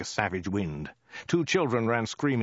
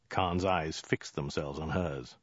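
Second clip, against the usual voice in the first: ordinary delivery, nothing marked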